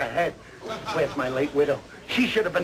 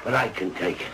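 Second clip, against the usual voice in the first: low, agonized sickbed moan; hoarse, suffering; 7 semitones lower than usual. Acted. Patient to wife after supposed operation, hoarse and suffering. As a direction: groan like a brave martyr with a sore throat. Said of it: "You don't sound so hoarse".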